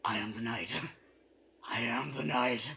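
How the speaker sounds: ordinary talk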